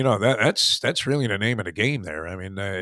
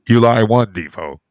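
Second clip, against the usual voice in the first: announced shout; 11 dB louder, slower than usual. Retold or imitated. Playing partner loudly announces the golfer's stroke count. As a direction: bark out the stroke count like an official. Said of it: "the guy looks at you and goes".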